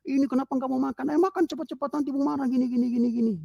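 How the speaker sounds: very high-pitched mock mother's nagging; ibu ngomel. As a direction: imitate a mum scolding her child to eat. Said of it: "ibunya ngomel-ngomel aja ibunya". (tiruan ibu mengomel dengan nada melengking)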